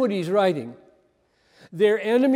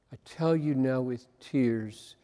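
much softer and lower, almost breaking; hushed, tearful repeat; 7 semitones lower, 8 dB quieter than usual. Shown as a demonstration. Preacher repeating Paul's words to his congregation, softly and tearfully. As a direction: let the words sink into a soft, tearful hush. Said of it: "could not get away from".

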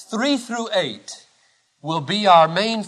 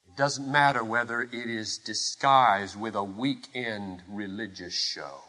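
low, soft, sinking to near murmur; hushed solemn preaching; 9 semitones lower, 9 dB quieter than usual. Acted. Preacher on disguised love of the world, hushed solemn. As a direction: drop the volume and let it land.

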